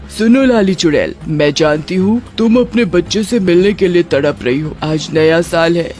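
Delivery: measured, commanding witch queen; witch queen, authoritative. Acted. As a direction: grant permission like a ruler. Queen witch addresses Lali.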